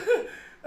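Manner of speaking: short high giggle burst. Real, not acted. Still amused at the chin, high giggle. Laughing at face.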